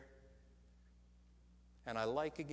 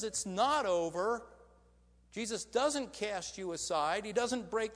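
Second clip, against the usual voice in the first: higher, louder preaching build; full-force preaching; 7 semitones higher, 7 dB louder than usual. Acted. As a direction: preach it out to the whole room.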